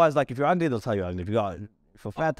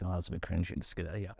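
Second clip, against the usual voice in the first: much softer, muttered under the breath; muttered aside; 7 semitones lower, 11 dB quieter, faster than usual. Retold or imitated. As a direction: mutter it quietly to your mate. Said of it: "I was like".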